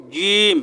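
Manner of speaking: জিম pressed firmly from the middle of the tongue; firm jim. Shown as a demonstration. Teacher showing students a firm জিম. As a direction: give জিম a firm, solid j. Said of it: "জিহ্বার মধ্যখান থেকে একটু শক্ত করে বলবেন".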